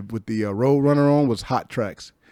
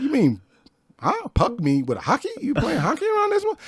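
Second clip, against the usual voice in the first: mocking confusion; 14 semitones higher than usual. Mocking her accent in argument.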